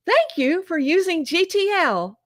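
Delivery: high, chirpy automated phone announcer; happy computer voice. Retold or imitated. Jail phone system cuts off the call, happy computer voice. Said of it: "in this happy computer voice".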